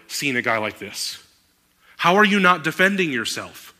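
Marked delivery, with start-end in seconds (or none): 2.0-3.7 higher, louder mock outburst at a silent prisoner; exasperated shout. Pilate to silent Jesus, exasperated higher louder shout. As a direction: yell it like a fed-up governor. Preacher voices Pilate's frustration.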